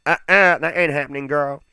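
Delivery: mock-sassy diva, high and loud on the "Uh-uh"; sassy refusal. Gamer answering Arsenal's seventeen million demand, sassy mock refusal. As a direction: shut it down with playful sass. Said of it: "so I'm instantly like".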